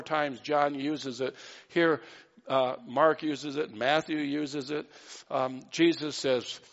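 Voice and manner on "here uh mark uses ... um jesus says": ordinary delivery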